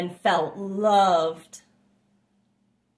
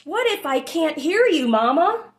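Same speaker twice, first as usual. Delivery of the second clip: put-on higher, childlike worried question; a little one afraid of losing mama; small child worried; 6 semitones higher, 3 dB louder than usual. A narrator's reading. Lightly voiced child asks mother, worried. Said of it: "little one asks, worried".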